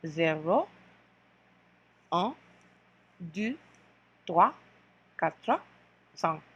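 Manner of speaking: slow French count, each number spaced; French count to five. Shown as a demonstration. Teacher counting zero to five in French for the class. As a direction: count zero to cinq slowly. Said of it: "We'll go zero to five in French".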